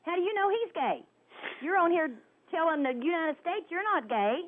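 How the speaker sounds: normal talking voice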